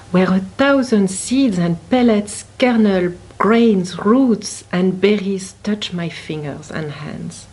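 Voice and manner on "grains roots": usual speaking voice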